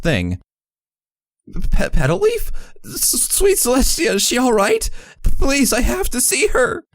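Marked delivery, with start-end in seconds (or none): 1.7-6.8 high-pitched, stammering panicked plea; dramatic, pained voice. Acted. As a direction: push the pitch up almost to breaking point, pleading and pained. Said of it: "my most dramatic and pained voice, letting my pitch go almost to breaking point".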